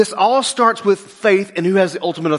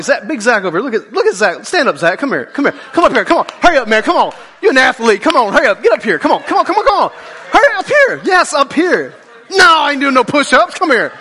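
loud, high, laughing call to come up; shouting across the room, laughing; calling him up; 6 semitones higher, 4 dB louder than usual. Real, not acted. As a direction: hurry him up to the front with a laugh. Calling Zach up front.

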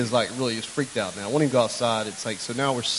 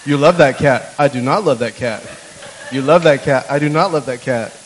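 loud sing-song back-and-forth; punchy, rhythmic mock argument; Doctor Seuss recital; 9 dB louder than usual. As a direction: bounce it out like a rhyming picture book. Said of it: "Doctor Seuss book going on right now".

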